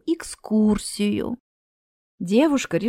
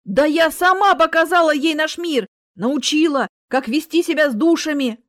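higher, louder indignant protest; возмущение; 6 semitones higher, 6 dB louder than usual. Acted. Character protests indignantly in story. (возмущённо, громко)

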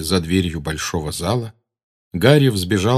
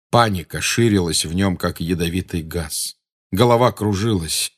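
plain reading voice

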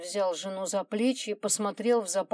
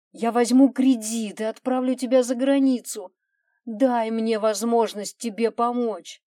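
loud, urgent, raised pleading; begging; 3 semitones higher, 7 dB louder than usual. A narrator's reading. Husband begs wife to open the door, imploring. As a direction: implore with rising insistence.

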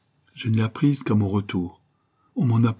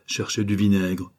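usual speaking voice

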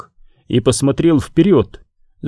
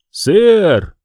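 shout; 6 semitones higher than usual. Acted. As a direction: call out through the door. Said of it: "крикнул Сарторис". (громкий окрик)

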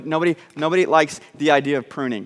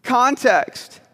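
higher, drawn-out "context"; self-important preacher word; 6 semitones higher than usual. Shown as a demonstration. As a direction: say "context" to sound really smart. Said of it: "it makes us sound really smart".

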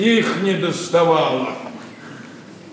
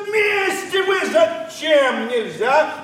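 angry shout; 9 semitones higher than usual. Acted. Father catches daughter with man.

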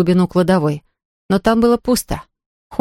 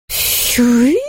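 high wailing cry; mournful ghost wail; 4 semitones higher than usual. A narrator's reading. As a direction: ghost cries out in grief. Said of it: "горестно вопил Хуга".

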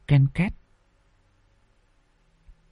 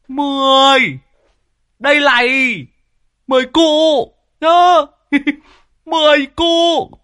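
triumphant crowing. Acted. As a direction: crow over the winning hand. Cardsharp crows over winning hand.

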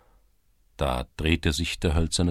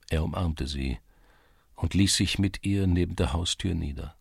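plain reading voice

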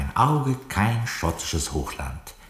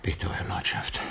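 whispered softly, close to his lord; soft whispered answer from the butler to his lord; hushed reply; 7 dB quieter than usual. Acted. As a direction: breathe it right at his lordship's ear. Lord asks him to come close.

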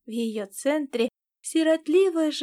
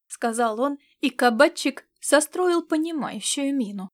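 ordinary delivery

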